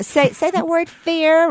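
high teasing New York drawl on fair; mock New York accent. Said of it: "imitating my New York accent".

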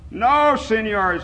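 high, slow, drawn-out call; pleading shout. A narrator's reading. Old man begs men to stop fighting, shouting. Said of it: "he shouted".

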